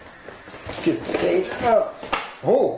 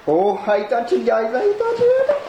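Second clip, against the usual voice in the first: thrilled squeal; 7 semitones higher than usual. Real, not acted. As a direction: squeal with real joy at touching it. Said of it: "I'm so excited!".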